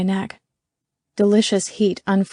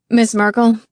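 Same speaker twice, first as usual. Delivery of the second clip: louder, projected question; calling on someone; 3 semitones higher, 7 dB louder than usual. A narrator's reading. McCarthy calls on Miss Merkel, projecting. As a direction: address her across the room.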